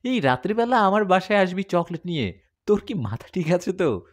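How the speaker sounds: put-on astonished girlfriend scolding on phone; startled. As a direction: she can't believe he'd come at night. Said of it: "চমকে গিয়ে বলল".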